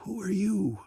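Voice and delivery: soft, high, feeble question; weak voice. A narrator's reading. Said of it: "asked in a weak voice".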